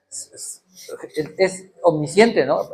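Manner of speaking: ordinary talk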